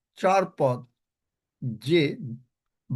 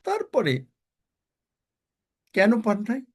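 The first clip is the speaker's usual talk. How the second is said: ordinary talk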